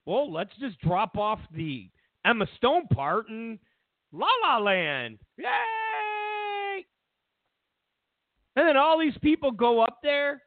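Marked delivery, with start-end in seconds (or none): 0.0-6.8 mocking the presenters. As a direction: play the clueless presenters, then cheer. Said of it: "These two dummies just went".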